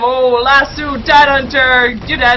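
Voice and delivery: loud burst with drawn-out words; loud, hyped game commentary; excited kill call. Real, not acted. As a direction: punch out the kill as a caster.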